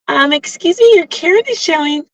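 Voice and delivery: high, singsong mock call-out; mock accusation. Someone calling out her Karen moment, mock accusing. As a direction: mimic a high-pitched busybody calling someone out.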